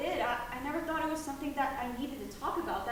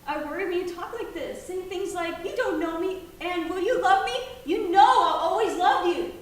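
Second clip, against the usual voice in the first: louder, higher worried plea; loud anxious plea; 5 semitones higher, 13 dB louder than usual. Acted. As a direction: plead with rising force. Mother pleads, still loud.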